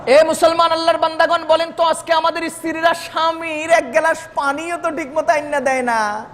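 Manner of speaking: ordinary delivery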